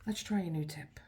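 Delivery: whispered to herself; a quiet aside while working. Real, not acted. Crafter whispering to herself while swapping tips.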